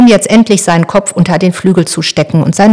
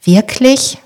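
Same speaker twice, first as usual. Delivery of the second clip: a frightened little question, pitched up; frightened young boy asking his sister; startled, unsure; 6 semitones higher than usual. Acted. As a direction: ask it high and anxious, suddenly worried. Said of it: "erschrocken und fragt unsicher".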